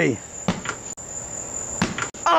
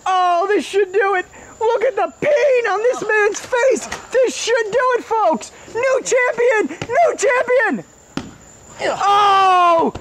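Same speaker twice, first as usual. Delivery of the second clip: frantic high play-by-play shouting; wrestling commentator; 10 semitones higher than usual. Acted. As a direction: call the match like it's the finale.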